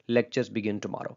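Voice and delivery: "Lectures begin tomorrow" slow, flat, falling at the end; simple statement. Shown as a demonstration. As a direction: say it as a plain fact, slowly. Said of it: "Very simple, ... simple statement". (सीधा बयान, धीमा और उतरता सुर)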